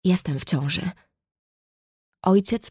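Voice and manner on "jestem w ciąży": understated nervous, frightened voice; mildly shaky, anxious admission; trembling with fear. A narrator's reading. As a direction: confess it with a touch of fear. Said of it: "drżącym ze zdenerwowania i strachu głosem".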